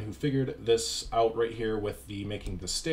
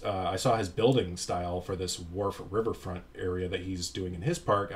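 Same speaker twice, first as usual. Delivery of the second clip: ordinary delivery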